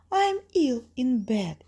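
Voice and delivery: high, fake granny voice; wolf pretending to be grandma. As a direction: pitch it up as a feigned sick granny. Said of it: "He's pretending to be grandma".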